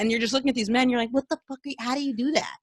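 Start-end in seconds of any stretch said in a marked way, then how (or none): 1.1-2.6 softer, higher, breathless awe; a hushed, higher gasp of admiration; awed hush. Retold or imitated. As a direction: murmur it as if stunned by beauty. Awed at gorgeous men.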